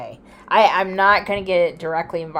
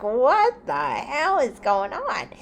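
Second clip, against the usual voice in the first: slow, higher, bewildered; startled; 7 semitones higher, much slower than usual. Retold or imitated. Woken by the alarm, slow startled disbelief. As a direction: caught off guard by the noise. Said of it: "I was like".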